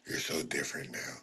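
mock quote of clingy chat; hushed whiny mock. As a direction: murmur it like a sulky fan. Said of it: "mocking chat message".

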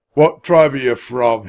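loud, slow, stern question; bark. A narrator's reading. Centurion questioning the children, barking. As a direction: bark it like an officer. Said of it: "he barked".

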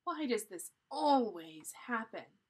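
"always" drawn out and punched loud; stress on always. A narrator's reading. Jack sighs to himself, heavy stress on "always". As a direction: lean hard and long on "always". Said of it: "say that word, um, a little different".

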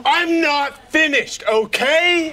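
furious shouting boss; angry shout. Real, not acted. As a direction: yell him down. Boss yelling over employee.

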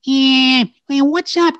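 put-on high Brooklyn-accented rabbit voice; Bugs Bunny Brooklyn voice. Pitching the character to the ad men, Bugs voice. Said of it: "kinda like a Brooklyn, Brooklyn accent".

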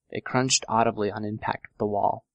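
ordinary delivery

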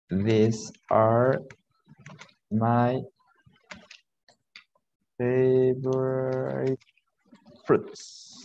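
word by word with long gaps; halting, stretched teacher dictation; slow dictation. Shown as a demonstration. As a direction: draw out "favorite" as if writing it. Teacher dictates sentence while writing.

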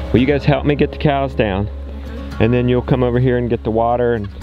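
ordinary talk

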